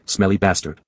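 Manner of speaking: a hint of a small child, soft and sulky; soft childlike voice. A narrator's reading. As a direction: say it softly, like a pouting kid. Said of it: "softly in a childlike voice".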